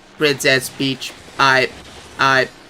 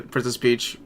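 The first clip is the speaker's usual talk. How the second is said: ordinary delivery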